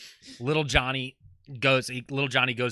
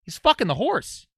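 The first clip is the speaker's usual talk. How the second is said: put-on excited kid; 9 semitones higher, 4 dB louder than usual. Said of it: "And he goes".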